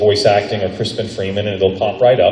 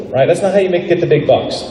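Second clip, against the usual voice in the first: ordinary talk, nothing marked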